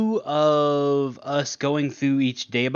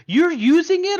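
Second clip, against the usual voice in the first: high, loud disbelieving question; incredulous retort; 7 dB louder than usual. Retold or imitated. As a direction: mimic someone aghast at the request. Retold incredulous reply at game table.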